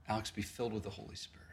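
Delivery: quiet, fast prayer over a friend; hushed quick prayer over the friend; soft prayer. Retold or imitated. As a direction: murmur the blessing softly and quickly. Praying over Alex.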